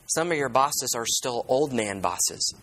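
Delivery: ordinary talk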